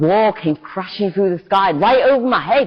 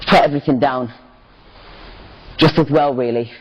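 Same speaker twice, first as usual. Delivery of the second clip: ordinary delivery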